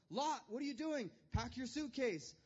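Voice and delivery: high, loud exasperated nag; scolding nephew. Retold or imitated. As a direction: hurry the lazy nephew along. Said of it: "Abraham scolding Lot".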